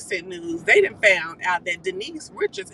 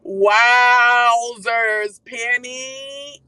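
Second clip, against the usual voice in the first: mock exclamation; 2 semitones higher, 8 dB louder, much slower than usual. Mocking exclamation.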